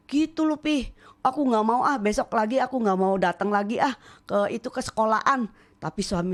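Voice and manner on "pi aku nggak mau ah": ordinary talk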